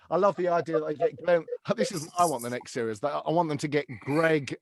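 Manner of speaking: normal talking voice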